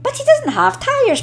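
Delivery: put-on high, young little engine voice; small engine voice. A narrator's reading. Percy pipes up to the big engines in a high little voice. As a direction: pitch it up like a small eager engine.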